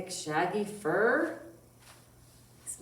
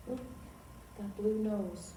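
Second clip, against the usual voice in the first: normal talking voice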